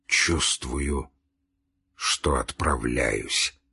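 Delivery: low, soft, very slow and effortful; slowly squeezed-out words. A narrator's reading. Dying Nikolai answers his brother, squeezing words out slowly. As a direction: push each word out with difficulty. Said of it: "медленно выжимая из себя слова".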